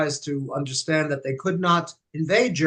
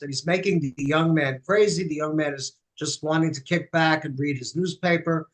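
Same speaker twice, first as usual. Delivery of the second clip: ordinary delivery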